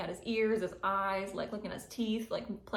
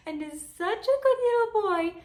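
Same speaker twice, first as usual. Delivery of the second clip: much higher, louder pet-voice praise; 12 semitones higher, 9 dB louder than usual. Real, not acted. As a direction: gush like talking to the cat. Praising cat in baby talk.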